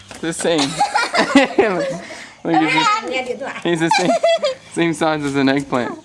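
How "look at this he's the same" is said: laughing. Real, not acted. Laughing at tiny chick.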